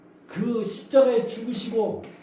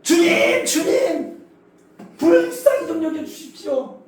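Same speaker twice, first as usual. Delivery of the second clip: higher, louder pleading cry; desperate crying out; 7 semitones higher, 6 dB louder, slower than usual. Retold or imitated. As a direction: cry out to the Lord for mercy. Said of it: "더 바락바락 소리를 지르는 거예요".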